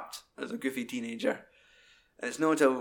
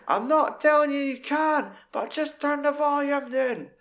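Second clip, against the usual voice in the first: shrill mother voice, much higher; nagging mum; 13 semitones higher, 4 dB louder than usual. Retold or imitated. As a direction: scold the son over the music.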